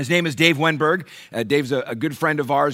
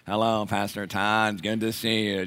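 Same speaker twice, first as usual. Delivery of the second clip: imitating Dave; 7 semitones lower, 7 dB quieter than usual. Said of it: "He walks in and he goes".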